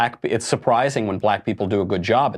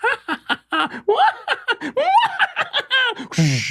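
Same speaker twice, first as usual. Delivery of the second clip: high shrieking witch cackle; mock evil cackle. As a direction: cackle like a witch off on her broomstick. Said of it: "I can just do the impression for you of Hillary".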